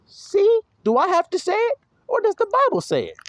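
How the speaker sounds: very high, squeaky questions; mock innocence. Preacher deflecting blame onto the Bible, high mock-innocent voice. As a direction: pitch it way up, playing innocent.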